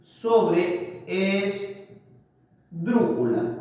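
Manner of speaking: "sobreesdrújula" very slow, in separate chunks; slowly broken-up word. Shown as a demonstration. Teacher naming the word class, slowly broken up. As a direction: say "sobreesdrújula" piece by piece with long gaps. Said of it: "Acá está la mayor fuerza de voz".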